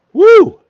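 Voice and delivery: high loud woo from the heat; loud burning whoop. Real, not acted. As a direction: let out a big pained whoop. Hot pepper burn hitting.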